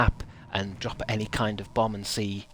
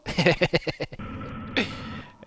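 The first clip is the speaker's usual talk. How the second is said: long, breathy real laugh; 7 semitones higher than usual. Real, not acted. Streamer laughing at their own Sheffield remark, real laugh. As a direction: laugh freely at your own joke.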